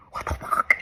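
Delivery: whispered, voiceless disbelief; stunned whisper. Real, not acted. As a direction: whisper it, too floored to voice it. Stunned after reading the prediction.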